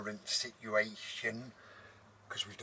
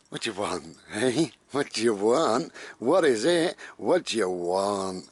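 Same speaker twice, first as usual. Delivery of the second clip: doting pet talk; 14 dB louder than usual. Real, not acted. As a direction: smother the dog with affection, loudly. Cooing over the dog.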